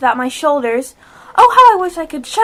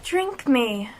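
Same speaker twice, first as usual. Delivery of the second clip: reading softly; 6 semitones lower, 9 dB quieter than usual. Acted. Alice reads the label.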